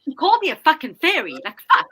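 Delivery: complaining to the teacher, re-enacted anger; indignant complaint. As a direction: tell the master off in a fury. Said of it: "I go".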